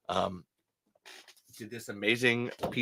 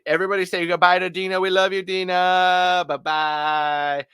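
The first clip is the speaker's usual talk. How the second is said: raised, cheerful send-off to the audience; loud farewell; 5 semitones higher, 6 dB louder than usual. Real, not acted. Host calling out goodbye to Dina, loud and cheerful. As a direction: call out goodbye to the crowd.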